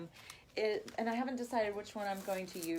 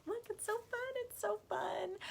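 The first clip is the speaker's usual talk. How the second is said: squealing delight; 12 semitones higher, 4 dB quieter than usual. Real, not acted. Delighted by ribbon.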